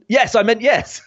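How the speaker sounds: high-pitched insistent protest; mock exasperation. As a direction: act the frustrated parent correcting a text. Mother vainly trying to say no.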